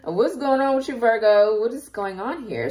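high, loud, laughing through the question; laughing disbelief. Real, not acted. Tarot reader laughing at her own emotion toward Virgo viewers, loud laughing question. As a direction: laugh it off as she calls out to Virgo.

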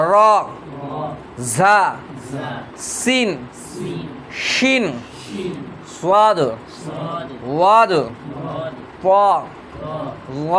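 Arabic letter names called out one by one, slowly, with pauses between; teacher chanting Arabic letters for students to echo; letter recitation drill. Shown as a demonstration. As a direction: recite the alphabet slowly for the class to repeat. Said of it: "আবার পড়ুন আলিফ".

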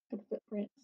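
ordinary delivery

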